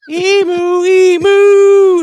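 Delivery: high, bleating emu-goat cry. Pretend. Co-host doing a goat sound on request. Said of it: "A goat".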